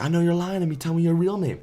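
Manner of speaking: the stranger calling out a fake name, mock angry and loud; mock angry demand. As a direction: call out the lie, hammed up. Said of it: "the guy's like".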